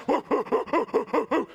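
loud, high string of woofs; imitated dog barking. Preacher mimics his dog at the door, frantic high barking. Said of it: "he's like".

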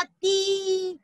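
ती with a long, sustained ee; long ee sound. Shown as a demonstration. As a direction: stretch the vowel in ती. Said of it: "उसका उच्चारण होगा". (ती को लंबी ई से खींचकर)

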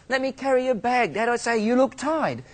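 butter up the father; wheedling little boy. Retold or imitated. Boy flattering dad to avoid spanking.

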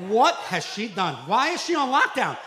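comic rant at twisty ties; exasperated rant. Real, not acted. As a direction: build the outrage. Ranting at Barbie packaging.

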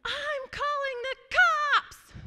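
put-on high, slow, screamed child's threat; little brother screaming. Little brother screams at his sister, high outraged yell. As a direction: shriek it like an outraged little boy. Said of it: "he screams and he goes".

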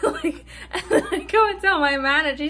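words half laughed, a little loud; laughing in disbelief. Real, not acted. After grandma goes on life support, laughing in disbelief. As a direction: talk on through a helpless laugh.

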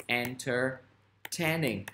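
very slow, syllable by syllable; slow word demonstration. Shown as a demonstration. Teacher giving students the adjective, slowly sounded out. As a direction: stretch entertaining out for learners.

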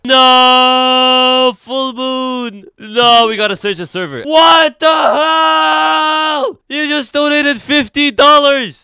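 loud, high yelling with drawn-out words; excited shouting. Real, not acted. Streamer reacting to a fifty dollar donation, high excited shout. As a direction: yell it out high, stretching the key words.

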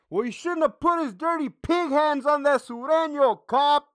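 high, sarcastic whiny voice mocking a critic; hammed-up sneer at the officer-haters; sarcastic mockery of critics who blame the officer. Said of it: "So you tell me how the officer".

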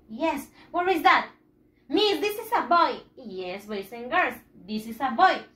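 ordinary delivery

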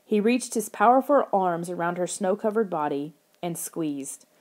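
ordinary delivery

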